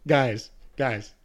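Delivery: loud, boastful call for attention; loud showing off. Pretend. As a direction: shout for everyone to look. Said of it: "me just wanting to".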